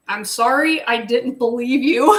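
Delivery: high, loud laughing apology. Real, not acted. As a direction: apologize through giggles.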